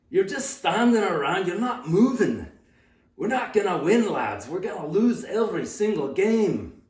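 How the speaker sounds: loud, high Scottish coach berating the team; Mister Brown. Retold or imitated. Scottish coach halts tryouts to scold his players, loud angry shout. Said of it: "Mister Brown was a Scottish guy".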